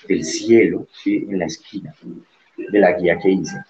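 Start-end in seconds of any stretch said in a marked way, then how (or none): none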